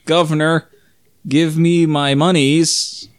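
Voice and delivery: slow, drawn-out comic Brit; hammed-up British demand, words stretched out; mock British accent. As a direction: put on an exaggerated British accent. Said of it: "That's a good British accent".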